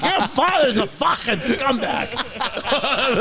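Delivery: high, slow, laughing mock of a tipsy mother; imitating drunk mom. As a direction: drag it out high, like mom in Mickey ears. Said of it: "She's like".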